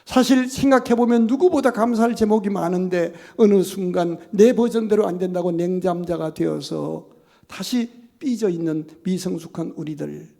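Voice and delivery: normal talking voice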